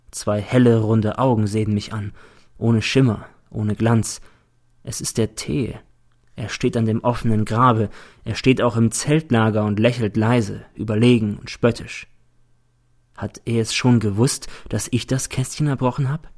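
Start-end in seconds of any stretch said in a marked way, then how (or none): none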